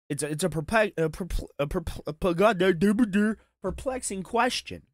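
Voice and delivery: exaggerated tongue-tied fumbling; mock stammering. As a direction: trip over the word in feigned bafflement. Feigns puzzlement, fumbling the word on purpose.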